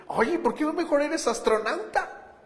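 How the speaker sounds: wife scoffing at her husband's dream, sarcastic; sarcastic retort. Retold or imitated. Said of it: "le responde sarcásticamente".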